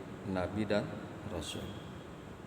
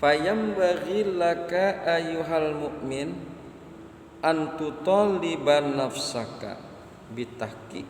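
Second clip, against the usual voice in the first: slow, higher and louder intoned Arabic reading; kitab recitation; 5 semitones higher, 4 dB louder, slower than usual. Acted. Teacher reading the Arabic kitab aloud to students, slow and intoned. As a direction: chant the passage like a pesantren teacher reading aloud.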